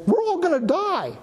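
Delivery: high-pitched, mock-panicked cry of the crowd. Preacher imitating the panicking public to his congregation, mock panic. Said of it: "everybody is going around saying".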